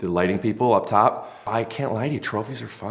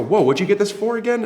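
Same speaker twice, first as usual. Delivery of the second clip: impressed guest; 12 semitones higher than usual. Retold or imitated. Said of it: "and they go".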